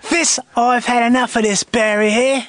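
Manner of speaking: hammed-up British thug accent; Guy Ritchie street tough. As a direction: threaten like a London film gangster. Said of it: "What about Guy Ritchie movie?".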